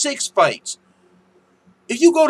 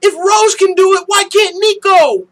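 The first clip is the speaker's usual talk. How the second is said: high, loud mocking troll voice; 11 semitones higher, 8 dB louder than usual. Mocking a chat troll's argument, loud and high-pitched. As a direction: mimic the troll's dumb claim. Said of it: "He said".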